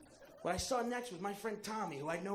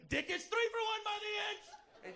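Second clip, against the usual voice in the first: hawker shout. Retold or imitated. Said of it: "He's like".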